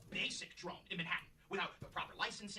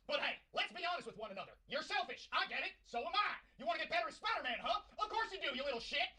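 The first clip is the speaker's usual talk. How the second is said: higher, barking drill sergeant berating gamers; gruff drill general; 6 semitones higher, 6 dB louder than usual. Acted. General scolding Marvel Rivals players, barking drill sergeant. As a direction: chew out the recruits like a general. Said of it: "Oh, General Valenti".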